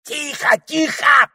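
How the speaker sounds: very high, loud yell; the parrot shouting the children down; shouted hush. Acted. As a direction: bark for silence.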